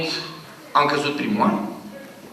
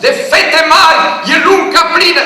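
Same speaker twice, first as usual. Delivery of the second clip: bellowed high and loud, full projection; full voice recital; 14 semitones higher, 14 dB louder than usual. Retold or imitated. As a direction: belt the verse across the wheat field. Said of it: "Pot să-mi fac și vocea?".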